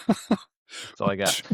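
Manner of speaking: normal talking voice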